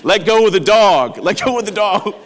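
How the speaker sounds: calling out. Real, not acted. Comic aside, calling out.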